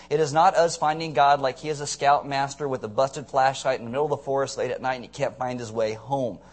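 ordinary talk

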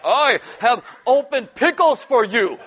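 loud, slow, hammed-up heroic boast; Greek god boast. Husband showing off the opened pickles, hammed-up boasting. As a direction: puff up like a proud hero. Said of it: "He'll be like".

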